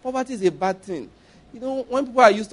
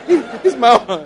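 slow, laughing, louder mock reply; laughing mock answer; 4 semitones higher, 6 dB louder, slower than usual. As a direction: laugh through the man's proud claim. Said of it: "The man said".

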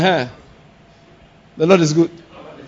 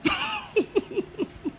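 high laugh; 12 dB quieter than usual. Real, not acted. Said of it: "No, I'm serious".

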